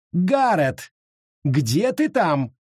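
high, teasing sing-song call; witch calling. Acted. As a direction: lure him out of hiding. The witch calls for Garrett.

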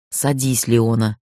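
low, measured menace; understated threatening command; insinuating threat. A narrator's reading. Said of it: "вкрадчивый угрожающий голос".

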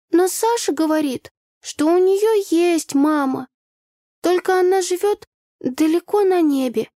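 put-on high, slow child voice; a small child thinking aloud, pitched high; little girl's voice. A narrator's reading. Daughter tells mother of Sasha's mum in the sky, high childish voice.

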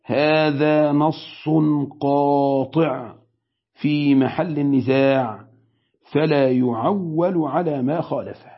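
very slow, every word weighed, قاطع dragged out; slow weighty quote. Shown as a demonstration. Sheikh quoting Ibn Qudama to students, slow and weighty. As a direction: read it like a verdict, slowly. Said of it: "كلمة حاسمة عظيمة وكبيرة".

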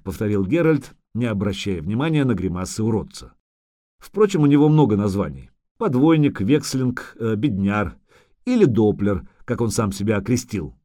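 ordinary delivery, nothing marked

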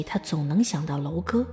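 usual speaking voice

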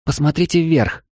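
high urgent call of an AI helper; Eva's voice. Acted. AI companion Eva calls out.

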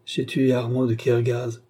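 plain reading voice

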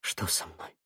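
weak breathy whisper; quiet. A narrator's reading. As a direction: barely get the words out. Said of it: "тихо".

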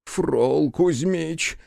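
drawn-out, moaning high plea; groaning plea. A narrator's reading. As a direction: moan the name in despair. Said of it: "простонал Иона".

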